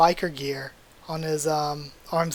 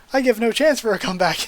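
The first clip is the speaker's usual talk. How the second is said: higher, louder, fast triumphant boast; fired-up hero; 5 semitones higher, 6 dB louder, faster than usual. Acted. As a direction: rattle it off like a hero who has already won. Hero's triumphant line.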